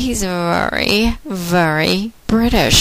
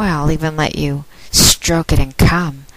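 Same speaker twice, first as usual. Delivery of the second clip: normal talking voice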